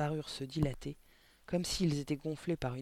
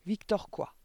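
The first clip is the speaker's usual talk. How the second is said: sharp question, higher than narration; demanding headmistress; 6 semitones higher, 4 dB louder, slower than usual. A narrator's reading. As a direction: demand an answer without yelling yet. Directrice interroge, premier essai. (question autoritaire, plus aiguë)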